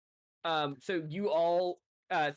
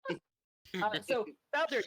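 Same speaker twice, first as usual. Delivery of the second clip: ordinary talk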